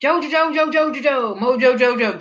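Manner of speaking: high, loud excited cry of the name; squealing JoJo over and over; excited calling out. Real, not acted. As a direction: burst out calling the name, winding down.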